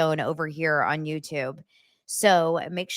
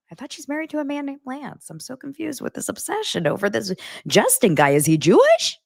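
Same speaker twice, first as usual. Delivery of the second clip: imagined mom voice, rising high; confused mom; 8 semitones higher, 3 dB louder than usual. Retold or imitated. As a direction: play a baffled mother, climbing to a high-pitched finish. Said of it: "From her stance, she, she's, like".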